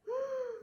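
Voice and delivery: gasp. A narrator's reading. Reaction to taunt.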